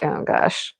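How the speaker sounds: whispered "Oh, gosh"; half-laughing whispered dismay; whispered mock dismay; breathy retold whisper. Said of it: "And I'm like".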